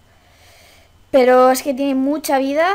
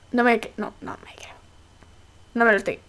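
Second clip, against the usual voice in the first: stunned whisper; 3 semitones lower, 4 dB quieter, faster than usual. Real, not acted. Disbelief whisper at fusion.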